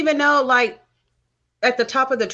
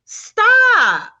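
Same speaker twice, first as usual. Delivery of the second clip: high, loud exasperated stop; sharp stop; 10 semitones higher, 6 dB louder than usual. Real, not acted. Scolding the TV character.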